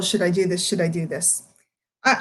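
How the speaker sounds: ordinary delivery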